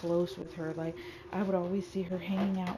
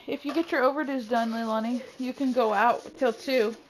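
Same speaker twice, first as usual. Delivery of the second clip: raised parental voice; somewhat louder, higher call; 4 semitones higher, 6 dB louder than usual. Real, not acted. Calls instructions to Leilani.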